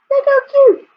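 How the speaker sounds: high, gushing delight at a cute piglet. Acted. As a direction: squeal over how cute it is. Showing a piglet picture.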